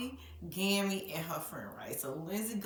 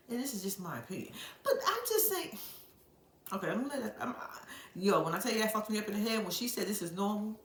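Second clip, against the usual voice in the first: ordinary talk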